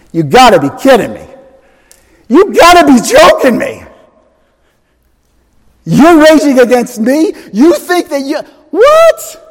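high incredulous mock disbelief; God's sarcasm. Preacher voicing God laughing at the nations, sarcastic disbelief. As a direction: scoff like you can't believe their nerve. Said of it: "The Lord holds them in derision".

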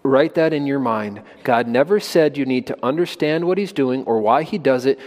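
ordinary talk

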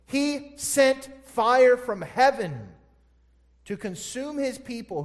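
loud, high and slow, every word weighted; slow pulpit emphasis. Acted. Pastor stressing God's judgment, slow pulpit emphasis. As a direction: land it hard and slow.

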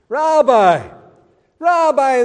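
loud, high mock-flattering Rabbi; feigned respect. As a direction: call him Rabbi with put-on reverence. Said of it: "They're pretending to be John's friends".

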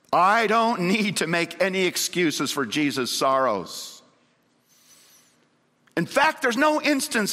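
impassioned pulpit declaration; fervent preaching. Real, not acted. Preaches a quotation.

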